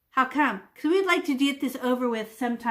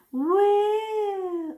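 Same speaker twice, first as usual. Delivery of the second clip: joyful woo; 9 semitones higher, 3 dB louder than usual. Real, not acted. Swooshing brush with joy.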